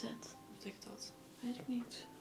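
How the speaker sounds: hushed puzzled whisper; whisper, unsure and spooked; nervous whisper. Real, not acted. Puzzled at ghost word.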